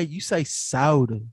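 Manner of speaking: SODA dragged out slowly as "sow-duh"; slow question with a long first vowel in "soda"; stretched-out soda. Retold or imitated. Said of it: "she was stretching it".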